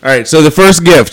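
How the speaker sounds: right up on the mic; too close to mic. Real, not acted. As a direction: lips against the microphone. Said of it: "talk so close to them".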